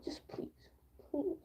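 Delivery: breathless whispered please. Retold or imitated. Begging him to leave her alone, whispered. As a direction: beg under her breath.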